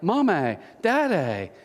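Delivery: "Mommy, Daddy" with British-style "-ay" endings; mock British accent; touch of British accent. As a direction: mimic British "Mum-may, Dad-day". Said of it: "with, like, a touch of, like, a British accent".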